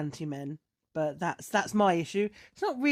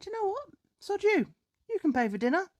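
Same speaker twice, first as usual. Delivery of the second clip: high-pitched, indignant kiss-off; mock-indignant send-off; retold fed-up dismissal; 13 semitones higher than usual. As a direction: act out storming off, voice pitched up. Retelling her fed-up walkout.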